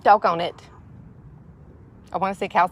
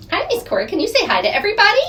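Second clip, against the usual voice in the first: high sing-song coaxing; coaxing pet; 11 semitones higher, much faster than usual. Real, not acted. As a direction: ask the hen sweetly to say hi. Coaxing hen to greet.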